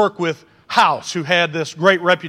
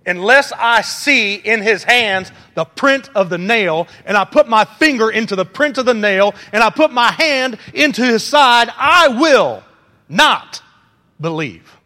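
defiant ultimatum; 3 semitones higher, 4 dB louder than usual. Shown as a demonstration. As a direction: demand it like an ultimatum, each put pushed harder. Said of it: "You give an ultimatum like this".

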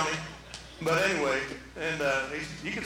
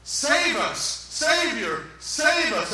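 higher, repeated urgent calls; crowd crying out; 6 semitones higher, 3 dB louder, much slower than usual. Acted. Preacher acts the crowd welcoming Jesus, crying out. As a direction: cry out for rescue over a crowd. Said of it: "And they're crying out".

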